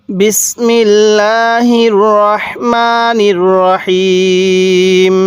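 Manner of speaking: slow, chanted recitation with long drawn vowels; Quranic recitation. Acted. Teacher opening the lesson, slow chanted recitation. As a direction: intone it like opening a Quran reading.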